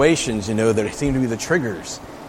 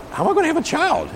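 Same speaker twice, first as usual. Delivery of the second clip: put-on much higher, girlish disbelieving question; bewildered Mary. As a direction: play a startled young woman. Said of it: "where Mary's like".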